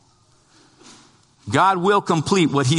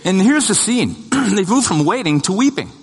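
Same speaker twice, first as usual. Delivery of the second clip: fervent preaching; 6 semitones higher than usual. Acted. The preacher urges the church to trust Jesus, fervent and high. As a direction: preach it hard, climbing to a plea.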